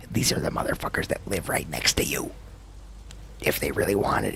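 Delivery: breathy late-night whisper to the stream. Real, not acted. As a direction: whisper it so the neighbors won't hear. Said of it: "I know I'm still whispering".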